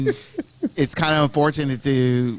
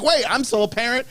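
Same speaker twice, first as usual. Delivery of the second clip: higher-pitched mock protest; mimicked absent parent; 10 semitones higher than usual. Man mimics absent parent suddenly popping in, higher mock protest. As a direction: pipe up like an indignant part-time parent.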